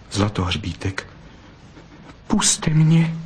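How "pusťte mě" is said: pleading. A narrator's reading. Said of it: "zaprosila Bystrouška".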